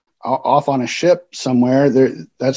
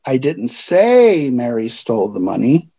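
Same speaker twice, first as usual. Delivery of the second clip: "say" punched, the other words even; stress on SAY; 2 semitones lower than usual. Shown as a demonstration. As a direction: lean hard on "say". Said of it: "because of the way I emphasized a word".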